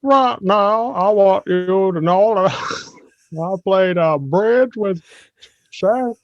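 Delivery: a Charles Barkley's mom bragging, high and slow; Mama Barkley impression. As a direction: play a sweet old mother, drawn out. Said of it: "let me try to do, Mama Barkley".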